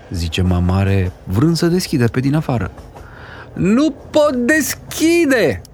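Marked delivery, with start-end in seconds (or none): 3.5-5.6 high, slow, drawn-out wail; desperate bawl. A narrator's reading. Boy unable to open the locked door, desperate bawl. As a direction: stretch each word into a helpless high cry. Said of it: "zbiară Goe desperat".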